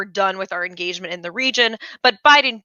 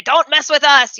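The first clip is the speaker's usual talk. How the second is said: higher, louder mock-tough Putin; imitating Putin's tough talk; 5 semitones higher, 5 dB louder than usual. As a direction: puff up like a posturing strongman. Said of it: "kind of classic Putin".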